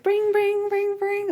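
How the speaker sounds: high sing-song bell ringing sound; squeaky bike bell, said not played; bell ring imitation.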